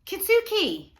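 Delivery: very high, very loud calling out; boy shouting to his friend in joy. Acted. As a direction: yell his name across the hilltop. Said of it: "I cried".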